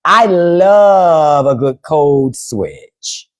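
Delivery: very slow, stretched line in a put-on voice; demonstrating a code switch by drawling; slow drawn-out code switch. Shown as a demonstration. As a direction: drag it out in a different voice.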